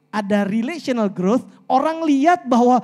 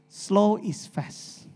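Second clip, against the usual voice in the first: very slow, softer quote of Bo; 3 semitones lower, 6 dB quieter, much slower than usual. Retold or imitated. Quoting his slow-speaking mentor Bo, very slow and soft. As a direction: draw each word out like a calm mentor. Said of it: "Emang dia kalau ngomong lambat sih".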